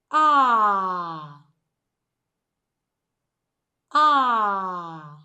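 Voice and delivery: "Ah" drawn out loud, gliding down; long stressed vowel. Shown as a demonstration. Teacher has class repeat the vowel, long and stressed. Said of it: "So let's do it again".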